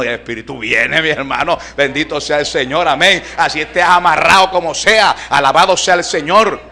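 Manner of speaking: loud, high Pentecostal exhortation; fervent preacher's cry, pitch and volume up; full-force preaching. Real, not acted. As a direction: shout it out to the whole congregation.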